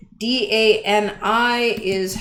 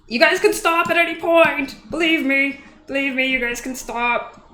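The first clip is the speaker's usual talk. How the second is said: higher, louder playful plea to the chat; a teasing put-on voice, begging them to stop; mock pleading; 5 semitones higher, 4 dB louder than usual. Streamer teasing his chat, begging them to stop in a higher put-on voice.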